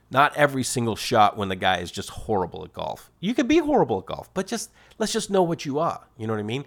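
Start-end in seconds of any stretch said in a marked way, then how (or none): none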